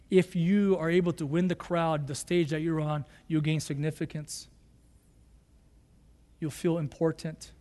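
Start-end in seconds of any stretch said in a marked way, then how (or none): none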